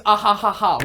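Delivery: mock the fake laugh people never do. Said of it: "nobody says".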